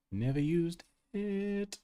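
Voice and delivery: NEVER USED IT slow and high, pausing before IT; playfully drawn out. Pretend. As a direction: stretch out NEVER USED IT, holding off the last word. Playful stretched repeat.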